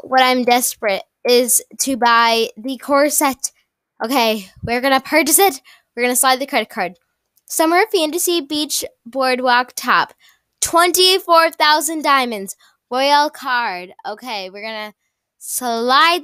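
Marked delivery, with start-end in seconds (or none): none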